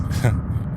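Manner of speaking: short breathy laugh. Real, not acted. Laughs mid sentence.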